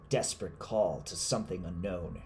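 ordinary delivery, nothing marked

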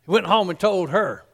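ordinary talk, nothing marked

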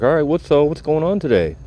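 mock-upbeat doctor voice; imitating the doctor. Retold doctor greeting him.

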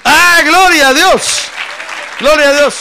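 very loud, high, drawn-out cry; high-pitched revival exclamation; exultant praise shout. Real, not acted. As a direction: shout glory to God at full force. Preacher bursts into praise.

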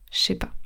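„Je sais pas” softly as „shay pa”; potoczne ściągnięcie. Shown as a demonstration. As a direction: merge „Je sais” into a soft „shé”. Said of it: "ça devient chez".

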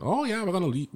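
a quick mock Irish lilt; Irish accent. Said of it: "they had an Irish accent".

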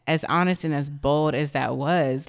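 ordinary delivery

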